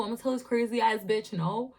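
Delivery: usual speaking voice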